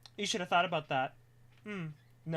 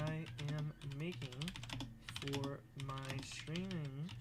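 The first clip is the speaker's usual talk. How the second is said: very soft, halting dictation; composing aloud; 2 semitones lower, 15 dB quieter, slower than usual. Real, not acted. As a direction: murmur word by word while writing. Composes Discord message aloud.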